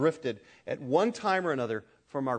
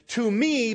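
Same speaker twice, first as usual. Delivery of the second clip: "to me" pitched up for emphasis; emphasized TO ME; 8 semitones higher, 5 dB louder than usual. Shown as a demonstration. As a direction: lift and lean on "to me". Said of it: "So to me is emphasized".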